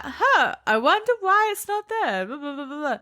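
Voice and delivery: high, airy imitation of a clueless girl, trailing off into blah blah; mock oblivious wondering. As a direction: mimic her puzzled musing, then wave it away. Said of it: "And she was like".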